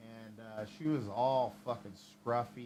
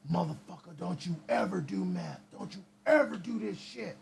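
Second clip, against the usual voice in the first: imitating a high-pitched stern warning; stern lecture; 4 semitones higher than usual. Meth head warning the teenager off meth, higher stern lecture. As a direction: scold the kid like a wired older guy.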